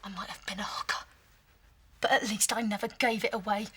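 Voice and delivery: low, soft, fast bitterness; bitter whisper. Acted. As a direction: whisper it defiantly. Hushed quarrel.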